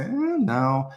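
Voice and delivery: high, wavering, reluctant no; iffy no. Shown as a demonstration. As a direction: hedge it with a drawn-out, hesitant "eh". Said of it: "that's kind of iffy".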